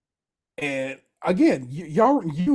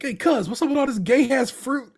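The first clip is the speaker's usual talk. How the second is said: mock Richie jab; 8 semitones higher, 4 dB louder than usual. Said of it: "he's like".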